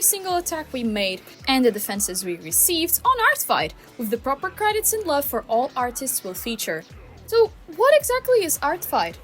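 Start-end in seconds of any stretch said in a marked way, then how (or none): none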